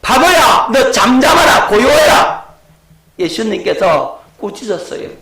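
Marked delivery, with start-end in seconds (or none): 0.0-0.7 loud, high scolding shout at the wind; Jesus shouting down the wind and waves; stern rebuke. Acted. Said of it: "바람과 바다를 인격체로 대우해서 꾸짖었는". 0.7-1.7 loud, high rebuke; shouted command. Acted. 1.7-2.5 loud, high scolding shout at the wind; Jesus shouting down the wind and waves; stern rebuke. Acted. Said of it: "바람과 바다를 인격체로 대우해서 꾸짖었는".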